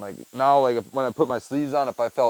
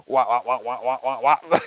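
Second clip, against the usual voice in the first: muffled wah wah talk; 4 dB louder than usual. Retold or imitated. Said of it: "I'll be like".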